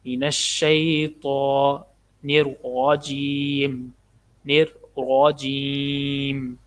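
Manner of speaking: الرجیم with a thick full ر, slowly; heavy ra. Shown as a demonstration. Teacher showing the heavy ra, slow recitation. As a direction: open the ر of رجیم thick and full. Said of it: "را کو موٹا پڑھنا ہے".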